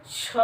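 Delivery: छ stretched out with a push of air; long, airy छ for the class; aspirated छ. Shown as a demonstration. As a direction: demonstrate छ with plenty of breath. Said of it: "छ जब हम कहते हैं तो इसमें क्या होती है".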